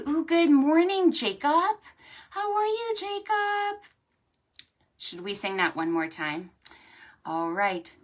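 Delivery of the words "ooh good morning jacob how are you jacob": high, loud greeting; high, bright welcome to Jacob. Real, not acted. Greeting late child.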